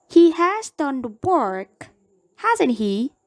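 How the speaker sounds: He has done the work pitched high, hasn't he rising; tag question tune. Shown as a demonstration. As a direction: ask for agreement with a tag rise. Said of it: "Take question".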